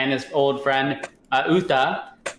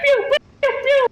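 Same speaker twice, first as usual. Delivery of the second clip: very high pew pew blaster noises; playful imitated shots; mouth gunfire; 4 dB louder than usual. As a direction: make toy laser sounds. Imitating shooting in story.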